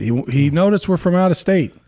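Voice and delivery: mild mock shout. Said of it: "And the guy shouts it".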